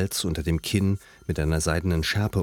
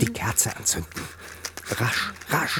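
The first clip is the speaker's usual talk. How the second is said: panicked; 6 semitones higher than usual. Acted. Panicked urging to light candle.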